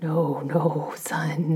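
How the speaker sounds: ordinary delivery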